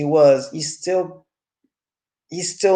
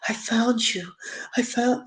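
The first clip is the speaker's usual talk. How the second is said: higher, soft breathy imitation; mock unprojected whisper; 8 semitones higher, 7 dB quieter than usual. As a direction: say it without projecting at all. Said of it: "She never projects".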